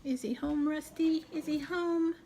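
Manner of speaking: excited pet talk. Real, not acted. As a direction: get the dog excited with a high, eager question. Talking to the dog Rusty.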